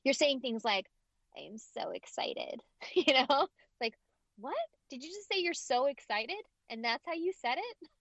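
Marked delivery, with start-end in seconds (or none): 1.4-2.6 mock excitement drained of all emotion; emotionless fry. Mimicking people who fry their voice, emotionless. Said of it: "taking all of the emotion out of your voice". 4.4-7.9 higher, incredulous questioning; mock disbelief. Acted disbelief at emotionless line.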